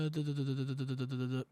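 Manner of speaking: low, soft filler syllables while reading; absent-minded skim-reading; skimming under the breath. Real, not acted. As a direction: mumble quietly as you scan a page. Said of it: "I'm reading it real quick".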